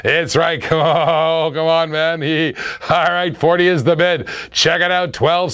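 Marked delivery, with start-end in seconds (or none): none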